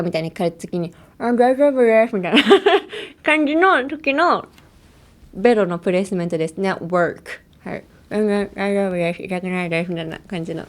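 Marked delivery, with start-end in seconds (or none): 1.2-2.1 garbled, higher patient voice with a laugh in it; tongue curled up talking. Acted. Patient answering the doctor while showing under the tongue, comically garbled. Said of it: "ベロの下見せてくださいみたいな時に". 8.1-9.9 mushy, garbled patient reply; tongue curled up talking. Acted. Patient telling the doctor it doesn't hurt, tongue held curled, garbled. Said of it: "ベロをくるくるってやったままの".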